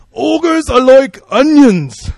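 slow, loud, high Scottish ogre voice; Scottish accent. Retold or imitated. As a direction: bellow it in a clumsy Scottish accent. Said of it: "I'm trying to do the Scottish accent".

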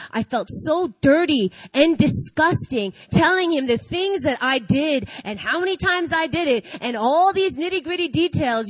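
usual speaking voice

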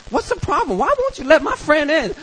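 high-pitched tipsy complaint; mock drunken whine in a high voice; drunk female friend. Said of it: "she was all drunk and she's like".

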